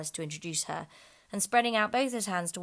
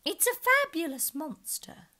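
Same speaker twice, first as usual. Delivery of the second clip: high, loud excited call; eager unicorn cry; 11 semitones higher, 8 dB louder, slower than usual. Acted. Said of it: "the unicorn cried out".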